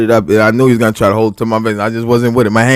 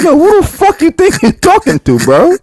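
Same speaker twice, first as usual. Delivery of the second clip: high, loud furious yell; imitating his own furious yell; angry yell; 5 dB louder than usual. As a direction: bark it at the guy's face. Retold confrontation, yelling at guy.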